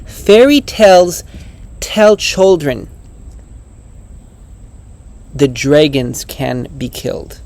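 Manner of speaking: high, slow recital with weighty pauses; dramatic quote reading. Real, not acted. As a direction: read it as a powerful quote, letting each phrase land.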